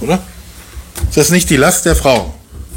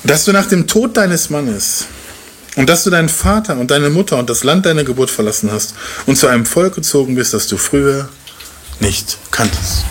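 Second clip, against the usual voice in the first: solemn scripture reading. Acted. Reads scripture slowly and solemnly.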